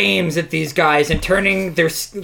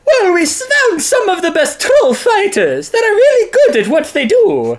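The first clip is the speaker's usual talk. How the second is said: much higher, louder voice through laughter; squeaky laughing character; 14 semitones higher, 4 dB louder than usual. Acted. Comic character bragging about fighters, squeaky and laughing. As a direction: squeak it out while cracking up.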